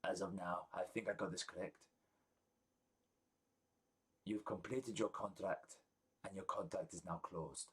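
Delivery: a lightly voiced king, quiet and formal; solemn king. King formally closing the party's contract, quiet and solemn. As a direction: close the contract gravely and softly.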